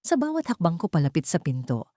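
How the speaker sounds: usual speaking voice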